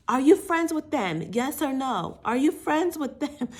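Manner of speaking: high, loud demanding impression; Sammy from Jersey Shore. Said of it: "Sammy from Jersey Shore when she's like".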